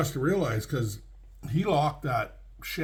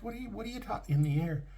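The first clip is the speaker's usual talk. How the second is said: higher, fast, baffled sputter; incredulous; 8 semitones higher, 3 dB quieter, much faster than usual. Retold or imitated. Trucker baffled by driver's floating woman story, incredulous. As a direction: rush out the question in disbelief.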